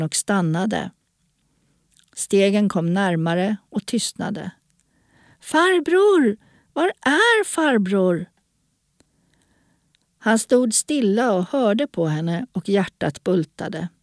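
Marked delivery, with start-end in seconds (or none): 5.4-8.3 high, drawn-out searching call; girl calling out. A narrator's reading. Lost girl searches for the man among trees, high drawn-out call. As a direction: call high into the dark for someone hidden. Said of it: "ropade hon".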